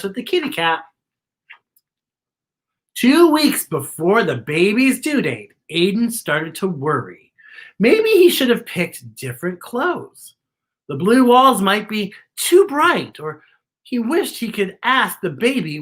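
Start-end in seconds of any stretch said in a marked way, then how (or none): none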